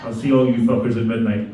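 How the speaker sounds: ordinary talk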